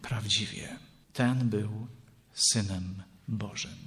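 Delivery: hushed, low, slow realisation; awed fear. A narrator's reading. Centurion and guards after the earthquake, hushed awe. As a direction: say it softly and slowly, with pauses. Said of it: "zlękli się bardzo i mówili".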